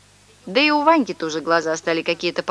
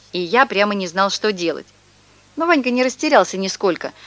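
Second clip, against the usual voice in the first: plain reading voice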